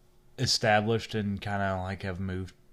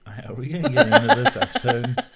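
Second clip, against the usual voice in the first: fast laughing high voice; 10 dB louder, faster than usual. Real, not acted. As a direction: push through the laugh. Laughing, changing subject.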